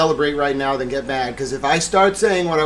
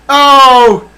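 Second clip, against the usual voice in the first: high loud joyous oh; ecstatic cry; 12 semitones higher, 16 dB louder than usual. Real, not acted. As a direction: let out a cry of bliss.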